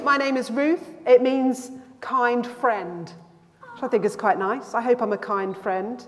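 usual speaking voice

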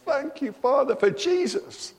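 high, lifted voice praising Father in thanks; joyful morning prayer. Shown as a demonstration. Believer thanking the Father each morning, high joyful prayer. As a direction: pray it out high and glad, like waking up thankful. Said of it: "you wake up every morning and say".

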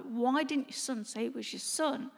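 usual speaking voice

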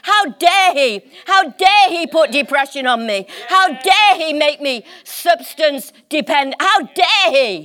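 indignant preacher, hammering each "how dare"; worked-up indignation. Real, not acted. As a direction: preach it like a righteous protest. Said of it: "I'm getting worked up".

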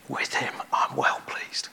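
soft hushed whisper of blessing; a tender whisper, no voice. Acted. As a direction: breathe it gently over each listener. Jesus speaks blessing over listeners.

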